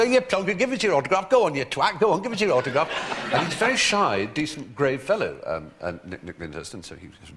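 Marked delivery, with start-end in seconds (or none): 0.0-2.9 high, loud mock-Cockney badgering; bad Cockney accent. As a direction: pester like a lad faking Cockney. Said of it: "rather bad approximation of a Cockney accent".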